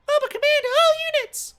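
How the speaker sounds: very high, loud radio bark; radio command. Acted. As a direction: blast it over the wireless. Director over radio.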